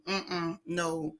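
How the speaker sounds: ordinary talk, nothing marked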